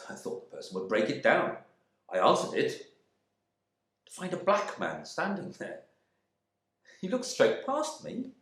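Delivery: plain reading voice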